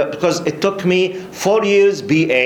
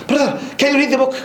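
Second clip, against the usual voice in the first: high, rapid-fire insistent quizzing; challenging questioner; 10 semitones higher, faster than usual. Retold or imitated. Questioner fires another book at the student, challenging. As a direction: imitate a man firing test questions, pitched right up. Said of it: "He was asking me in this attitude".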